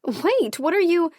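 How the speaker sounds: high, loud startled protest; alarmed protest. Acted. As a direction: cry out while being yanked along. Being yanked away, protesting.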